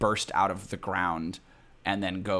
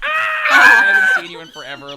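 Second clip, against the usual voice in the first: hammed-up girly greeting scream; Jessica Walters; 17 dB louder than usual. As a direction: shriek a very high, loud, gushing hello. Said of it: "the Arrested Development Jessica Walters".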